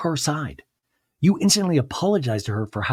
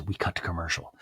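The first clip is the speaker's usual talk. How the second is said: normal talking voice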